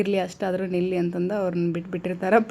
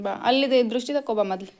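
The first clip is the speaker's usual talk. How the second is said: ordinary talk, nothing marked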